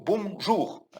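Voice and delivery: "Bonjour" glued into one word, no gap; words joined. Shown as a demonstration. Teacher insisting students join "Bonjour" into one word. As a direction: stick the two parts of "Bonjour" together. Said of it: "coller les deux mots".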